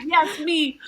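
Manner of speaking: high, loud, laughing yes; delighted. Retold or imitated. Host gushing over the program names, high and laughing. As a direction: squeal it, thrilled to be seen. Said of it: "I'm like".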